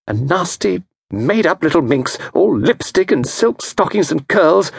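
mild jealous rush; rushing resentful wife. Acted. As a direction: spit it out about the rival. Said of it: "The words rushed from her".

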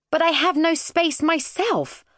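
put-on high child's complaint; indignant protest. Squashed child protesting to attendant.

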